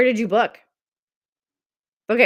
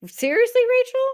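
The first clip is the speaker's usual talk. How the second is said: surprised; 3 dB louder than usual. Real, not acted. Reacting to a viewer's comment.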